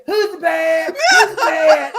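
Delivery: hammed-up imitation of [name]'s yelp; playful mock shout of [name]; [name] impression. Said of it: "Like [name] said".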